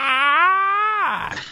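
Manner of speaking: put-on high, loud toddler's happy shriek; retold excited scream. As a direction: shriek like a thrilled little girl. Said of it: "she just lets out this big scream".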